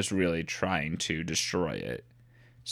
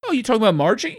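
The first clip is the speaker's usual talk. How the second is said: mock surprise; 14 semitones higher, 6 dB louder, much faster than usual. Mock gasp about Margie.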